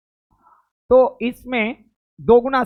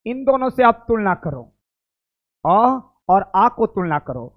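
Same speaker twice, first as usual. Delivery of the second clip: ordinary talk, nothing marked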